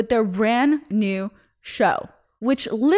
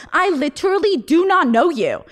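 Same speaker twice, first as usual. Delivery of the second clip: high, emphatic imitation of a snub; pitched-up, pointed mock rejection; mock aggressive; 7 semitones higher, 4 dB louder than usual. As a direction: mimic her aggressive, insistent brush-off. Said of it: "the person to be so aggressive".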